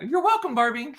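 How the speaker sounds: very high, sing-song "You're welcome, Barbie"; squeaky replay. Retold or imitated. Dad replaying his comeback to Barbie, high sing-song. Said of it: "we shouldn't say anything back".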